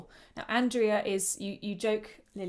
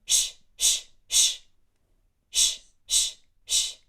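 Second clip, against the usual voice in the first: loud unvoiced shushing hiss; steady shh; 9 dB louder, slower than usual. Shown as a demonstration. Teacher demonstrating breath bursts to the choir, loud shh. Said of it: "and just go shh".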